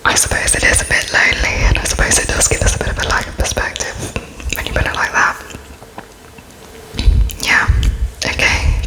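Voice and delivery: ordinary talk, nothing marked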